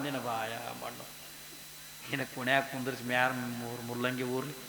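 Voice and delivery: low, soft grumbling curse; village woman cursing. Retold or imitated. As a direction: mutter a woman's curse under the breath. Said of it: "ಹೆಣ್ಮಕ್ಳು ಬೈತಾರಿಲ್ಲ". (ಕೆಳ ಧ್ವನಿಯಲ್ಲಿ ಹಳ್ಳಿ ಹೆಂಗಸಿನ ಶಾಪ)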